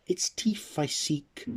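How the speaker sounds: a secretive fairy confiding; mysterious fairy. Acted. The fairy telling Nicholas what she wants, mysterious. Fairy states her desire.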